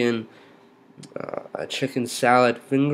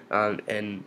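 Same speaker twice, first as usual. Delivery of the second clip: ordinary talk, nothing marked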